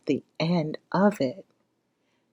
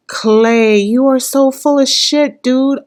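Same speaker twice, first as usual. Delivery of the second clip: louder, higher call-out at the screen; exasperated scolding; 6 semitones higher, 6 dB louder than usual. Real, not acted. Viewer scolding Clay through the TV, louder and fed up. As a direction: tell him off, fed up.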